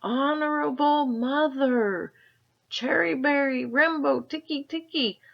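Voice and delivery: gasping. A narrator's reading. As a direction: stumble over the name, out of breath. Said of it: "he gasped".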